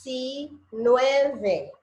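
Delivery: slow, each syllable separate; drawn-out teaching pace. Shown as a demonstration. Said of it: "don't go fast".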